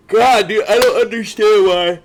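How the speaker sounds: loud, high burst of frustration; real exasperated cry; exasperated outburst. Real, not acted. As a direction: blurt it out, fed up with your own yawning. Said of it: "I fucking yawn".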